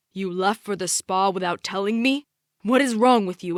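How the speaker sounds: furious husband yelling at his wife. Acted. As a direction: bark it at your wife in fury. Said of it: "or rather yelling at Mom".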